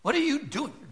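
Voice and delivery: put-on a mother catching her child at it; mom demanding. Preacher imitating a mother asking her kid, very high.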